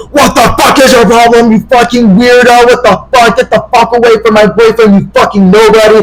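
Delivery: high, indignant mock rant; angry phone tirade. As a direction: imitate a furious boyfriend tearing into someone. Acting angry boyfriend on phone.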